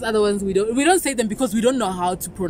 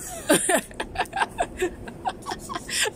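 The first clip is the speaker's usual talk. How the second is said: high breathless laugh; 7 dB quieter than usual. Real, not acted. Bursting out at the name.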